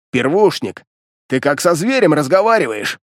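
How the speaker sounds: ordinary delivery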